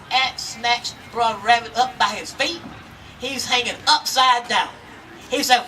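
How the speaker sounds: ordinary delivery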